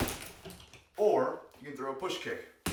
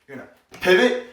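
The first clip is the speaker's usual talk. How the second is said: pivot punched louder and higher; emphasis on pivot; 4 semitones higher, 12 dB louder than usual. Shown as a demonstration. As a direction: lean loudly into the word pivot. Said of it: "Really emphasize on the pivot".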